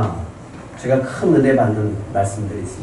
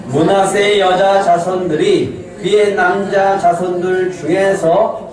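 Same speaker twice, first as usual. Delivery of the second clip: slow, raised congregational reading voice; loud scripture reading; 5 semitones higher, slower than usual. Real, not acted. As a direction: read it out slowly and high for all to follow. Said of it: "크게 읽겠습니다".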